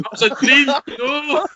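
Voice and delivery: loud, high-pitched wail of protest; real exasperated, amused yell; laughing outcry. Real, not acted. Laughing exclamation at dream ending.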